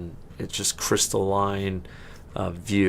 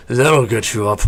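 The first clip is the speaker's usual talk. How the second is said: louder, gloating orc jab; smug orc; 3 semitones higher, 7 dB louder than usual. Acted. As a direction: tease the player as the orc. Orc mocks a fallen player.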